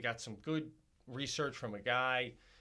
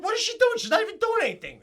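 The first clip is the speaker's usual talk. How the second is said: very high, loud outraged shriek; mock outraged wife; wife's outraged shout; 12 dB louder, faster than usual. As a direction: screech it like the fed-up wife. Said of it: "She's like".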